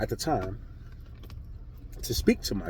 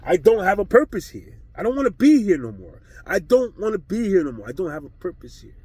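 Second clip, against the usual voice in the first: higher, louder, anguished cry out; reenacted despair; 5 semitones higher, 5 dB louder than usual. Cursing God about having no purpose, an anguished cry. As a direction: relive the hopeless outburst at God.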